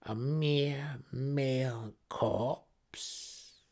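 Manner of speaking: drawn out, rising, each word savoured; slow menacing taunt. Acted. Priestess leans into her brother's face, slowly menacing him. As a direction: let the threat linger. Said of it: "She cackled once again".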